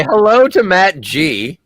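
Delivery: high, loud mock announcer greeting; co-host gives a listener a jokey, loud, high shout-out; playful shout-out. Real, not acted. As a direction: wave hello in a goofy voice. Cohost jokingly greets a listener.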